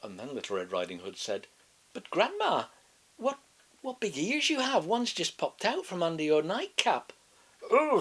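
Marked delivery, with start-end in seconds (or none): 1.9-7.1 put-on high, chirpy little girl. A narrator's reading. Girl telling the wolf about grandma, high innocent voice. 7.7-8.0 wolf faking a high, loud granny voice, hesitant; disguised voice. A narrator's reading. Wolf pretending to be grandma, clumsy disguised voice.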